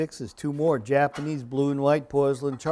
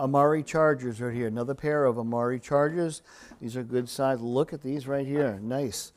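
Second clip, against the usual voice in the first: ordinary talk, nothing marked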